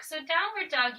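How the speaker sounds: normal talking voice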